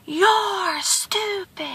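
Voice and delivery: high, soft, drawn-out call; faint faraway holler, stretched out; distant echo. A narrator's reading. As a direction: mimic the insult floating back. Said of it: "from the distance he hears".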